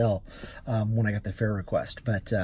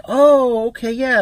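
high, louder "oh" of relief; retold relieved reaction; 13 semitones higher, 9 dB louder, slower than usual. Driver answers the passenger's reassurance, retold relief. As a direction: re-enact relief, pitched well up. Retells his relieved response.

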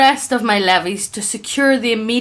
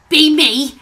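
slow, high, loud ork grunt; 6 semitones higher, 4 dB louder, slower than usual. Acted. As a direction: ham up a brutish ork. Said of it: "do the voice".

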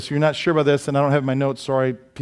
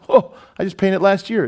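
higher-pitched surprised complaint; mock dismay; 6 semitones higher, 4 dB louder than usual. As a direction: start on a startled "oh". Acting out a dismayed homeowner.

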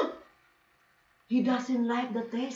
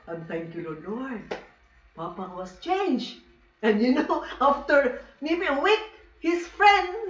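ordinary talk, nothing marked

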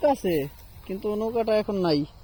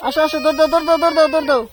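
urging; 6 semitones higher, 8 dB louder, faster than usual. Real, not acted. Father urging toddler to run.

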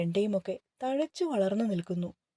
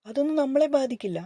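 said louder, a notch higher, brushing it off; raised, reassuring dismissal; firm dismissal; 5 semitones higher, 6 dB louder than usual. Acted. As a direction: wave her fear away firmly. Husband dismissing her worry.

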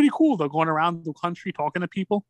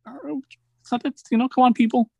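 exasperated plea; 7 semitones higher than usual. Retold or imitated. Retelling talking to panicked snowstorm shoppers, mock exasperated. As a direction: roll your eyes at the crowd.

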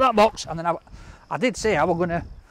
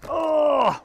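pained shout; 8 semitones higher than usual. Real, not acted. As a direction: shout out the chili burn. Mouth on fire from chili.

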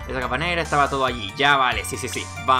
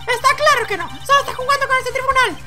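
high-pitched indignant courtroom voice; indignant accuser; 5 dB louder than usual. Acted. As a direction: accuse in a squeaky character voice. Personaje acusa al rival. (acusación indignada, muy aguda)

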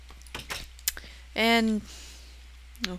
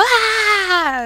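high, loud mock scream of fright; hammed-up jump scare yelp; 14 semitones higher, 11 dB louder than usual. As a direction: yelp as the monster jumps out. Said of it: "and you go".

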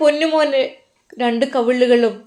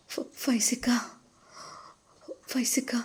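breathy trembling whisper of his name; 15 dB quieter than usual. Acted. Wife whispering husband's name, hushed.

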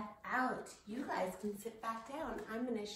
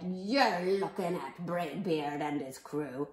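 hammed-up storybook sea dog; Braidbeard; 4 semitones lower, 10 dB louder than usual. A narrator's reading. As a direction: announce yourself like a pirate chief. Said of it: "Braidbeard told me".

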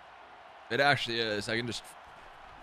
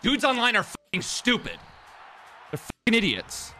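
high-pitched angry complaint; exasperated outburst; 12 semitones higher than usual. Real, not acted. Gamer fed up with online players, exasperated outburst. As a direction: let it burst out high and exasperated.

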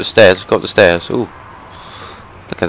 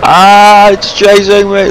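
high panicked yelling; scared horror game streamer; 9 semitones higher than usual. Real, not acted. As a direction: yell in terror while fleeing. Fleeing monster, high panicked yelling.

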